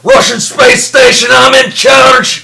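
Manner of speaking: loud, slow, high mock Russian accent. Insisting to his cohost the character is Russian, hammed-up Russian accent. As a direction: bellow it like a bossy cosmonaut. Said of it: "we've been doing these accents".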